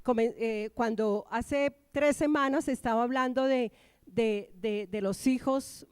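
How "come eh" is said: usual speaking voice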